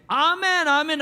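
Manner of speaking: loud high amen called out; calling amen. Acted. Preacher acting the worshippers' loud amen. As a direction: shout amen like a fervent worshipper.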